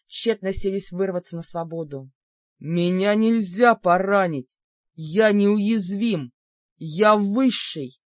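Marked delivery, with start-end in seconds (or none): 2.6-8.0 slow, loud bellowing demon; wounded demon roar. Acted. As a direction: howl like a wounded beast. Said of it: "Раненым зверем взмыл демон".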